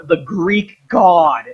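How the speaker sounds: ordinary delivery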